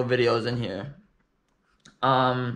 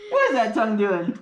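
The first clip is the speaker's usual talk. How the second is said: higher, laughing question; amused; 8 semitones higher than usual. Real, not acted. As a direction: ask through a laugh. Amused at baby's tongue.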